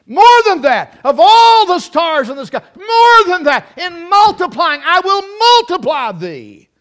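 loud, high, building sermon cadence; preaching at full force. Real, not acted. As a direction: drive the promise home with fervour.

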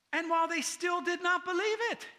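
high-pitched exasperated reading; incredulous frustration, pitched well up; frustrated. Pretend. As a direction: read it in rising exasperation. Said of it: "now I'm getting frustrated".

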